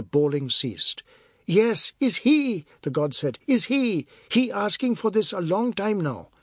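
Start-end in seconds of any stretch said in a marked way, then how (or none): 1.4-2.7 high, loud, drawn-out accusation; slow high-pitched denouncing; bawling boy. A narrator's reading. Boy bawls beside the fight. 3.5-6.3 high-pitched, worked-up accusation; excited high complaint; bawling boy. A narrator's reading. Boy goads the attacker.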